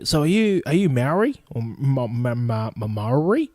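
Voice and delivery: tentative question, stumbling over the word; imitated hesitant asking. Educated American asking his background, hesitant and fumbling. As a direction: stammer towards an unfamiliar word, questioning.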